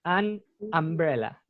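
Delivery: "An umbrella" slowly, opening with an ah: am-bre-la; drawn-out "An umbrella" with U said as A. Shown as a demonstration. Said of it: "esa U se pronuncia como A".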